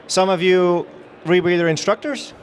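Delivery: ordinary talk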